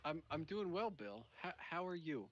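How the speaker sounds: far off and quiet in the mix; faint, low in mix. Real, not acted. Guest greets host but his volume drops far off. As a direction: sound buried too low in the mix. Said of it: "I feel like I've lost you, volume".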